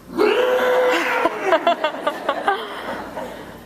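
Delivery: high, long uncontrollable laughing fit; helpless laughter. Real, not acted. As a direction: break into a laugh you can't stop. Overwhelmed by the letter.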